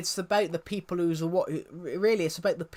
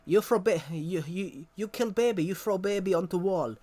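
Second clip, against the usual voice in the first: loud, higher, hammed-up Russian accent; mock Russian accent, louder; 3 semitones higher, 4 dB louder than usual. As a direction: yell the insult in a bad Russian accent. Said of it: "she's doing a Russian accent".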